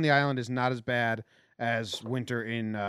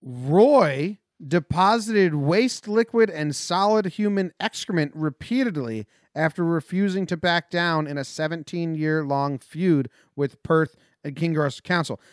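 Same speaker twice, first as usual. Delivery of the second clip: emphatic, measured newsreader delivery; news headline read; 3 semitones higher, 4 dB louder, slower than usual. Acted.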